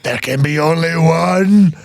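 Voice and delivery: slow, drawn-out epic declaration; mock-dramatic film line. Podcaster quotes the film's ending line, slow mock-epic delivery. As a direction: stretch it out grandly to the last word. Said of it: "ends with".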